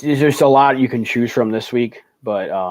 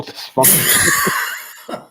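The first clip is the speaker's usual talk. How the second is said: laughing; 3 semitones higher, much slower than usual. Real, not acted. Cracking up at own joke.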